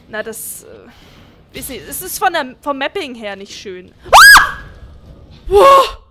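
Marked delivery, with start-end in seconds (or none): 4.1-4.6 sudden shrill scream of fright; scream, caught off guard; startled scream. Real, not acted. Jump scare in horror game. 5.5-6.0 loud startled gasp of a word; shocked exclamation. Real, not acted. Jump scare aftermath.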